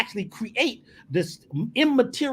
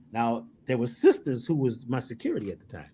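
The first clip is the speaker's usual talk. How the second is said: ordinary delivery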